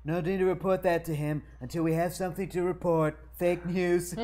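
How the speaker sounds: Trump voice. Acted. Trump-style line in read.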